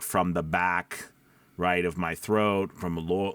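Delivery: usual speaking voice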